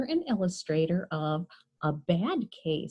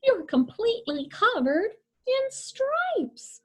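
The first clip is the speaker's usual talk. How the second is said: pitched way up in shock; high mock alarm; 13 semitones higher than usual. Mother sees her striped daughter, high startled cry. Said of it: "she cried".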